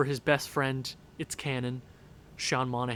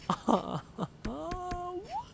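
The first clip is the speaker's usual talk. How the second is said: high despairing laugh; 12 semitones higher, 9 dB quieter than usual. Real, not acted. Reacting to goals against.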